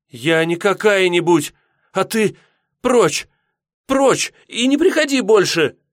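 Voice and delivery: high, loud, indignant shouting; furious outburst. Acted. Yulka drives her suitor away, furious shouting. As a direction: scream him out of the house.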